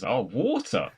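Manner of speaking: ordinary talk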